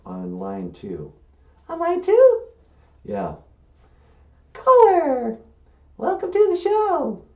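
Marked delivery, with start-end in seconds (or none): 1.7-2.4 high, loud puppet question; high female character, questioning. Acted. Puppet Emily echoes him. 4.5-7.2 high-pitched female show host; high puppet radio host; Emily. Acted. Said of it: "Emily, you have a call".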